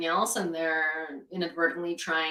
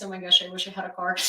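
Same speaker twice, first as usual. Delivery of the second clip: usual speaking voice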